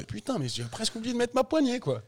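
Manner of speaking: high startled inner thought; sudden realisation. Retold or imitated. Rider at the start gate realises he forgot his handle, startled. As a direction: catch yourself forgetting, voice jumping up.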